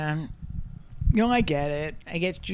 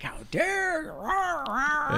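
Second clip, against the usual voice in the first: retold rage; 10 semitones higher, much slower than usual. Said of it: "I was screaming about it".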